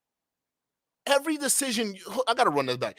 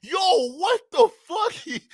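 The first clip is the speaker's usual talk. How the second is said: ordinary talk, nothing marked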